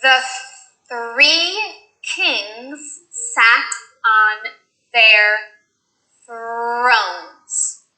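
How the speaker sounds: slow thr practice. Shown as a demonstration.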